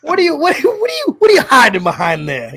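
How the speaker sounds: high incredulous squeak. Real, not acted. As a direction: pitch up in disbelief. Teasing about masks.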